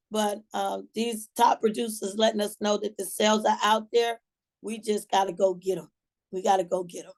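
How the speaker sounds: ordinary talk, nothing marked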